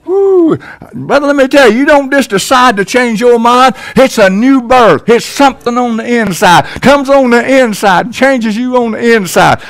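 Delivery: hammering the new birth home, fired up; fervent preaching. Acted. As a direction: lift the church up with fervor. Preaching at full force.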